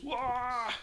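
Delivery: high sudden "wah" squeal; delighted squeal. Real, not acted. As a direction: squeal at a handsome photo. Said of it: "what was that noise".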